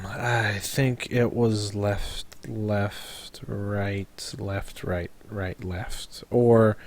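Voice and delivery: low, quiet, very slow recall; slow pondering. Acted. Recalling directions for the group, slow pondering. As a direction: mull over each word slowly and softly.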